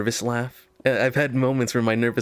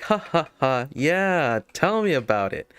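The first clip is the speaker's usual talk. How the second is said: mock placating chuckle, pitched up; forced chuckle; 5 semitones higher, slower than usual. Clerk de-escalating an upset customer, with a put-on chuckle. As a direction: chuckle along to smooth things over. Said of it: "My idea of de-escalation was smile, chuckle".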